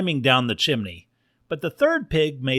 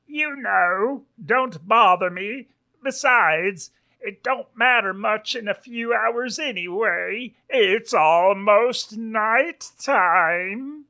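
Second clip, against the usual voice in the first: high Western drawl, loud drawn-out finish; dismissive sheriff pig; 8 semitones higher, 3 dB louder, slower than usual. Acted. Sheriff dismisses jailed wolf from behind his paper, high drawl. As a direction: brush him off high, stretching the last words.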